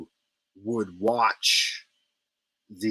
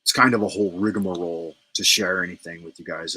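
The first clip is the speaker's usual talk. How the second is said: ordinary delivery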